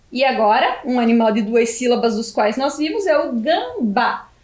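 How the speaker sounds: ordinary delivery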